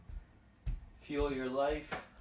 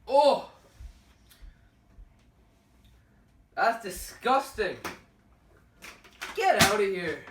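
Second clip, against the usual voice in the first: loud, high, disgusted; disgusted shout; 7 semitones higher, 11 dB louder, slower than usual. Real, not acted. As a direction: recoil loudly from the taste. Tasting the bad drink.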